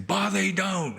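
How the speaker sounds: high-pitched imitation of an exasperated woman; exasperated female voice. Monk mimicking a village mother fed up with her crying baby, exasperated female voice. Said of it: "this exasperated female voice".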